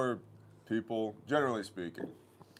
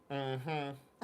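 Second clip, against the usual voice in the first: higher, drawn-out doubting hum; skeptical clerk mm-hmm; 6 semitones higher than usual. Retold or imitated. Clerk peering over her glasses, skeptical humming. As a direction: hum like she doesn't believe a word. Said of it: "lowers her glasses, looks at me and goes".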